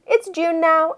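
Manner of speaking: ordinary delivery, nothing marked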